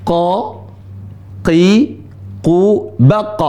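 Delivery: "qa, qi, ku, baq" each syllable separate and heavy; syllable drill. Shown as a demonstration. Teacher showing how qaf is read, syllable by syllable. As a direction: drill the letter qaf through its vowels.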